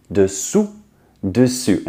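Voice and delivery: dessous ending in a rounded ou; dessous said carefully with the final ou rounded; French dessous. Shown as a demonstration. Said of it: "difference between dessous and dessus".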